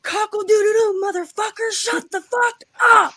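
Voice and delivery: high furious yell at the rooster; angry scream. Retold or imitated. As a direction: scream it out, mad as hell. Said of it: "And I scream 'cause I'm in the moment and I'm mad".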